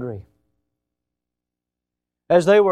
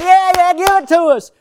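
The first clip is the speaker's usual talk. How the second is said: mock cheering crowd; 12 semitones higher, 9 dB louder than usual. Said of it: "everybody going".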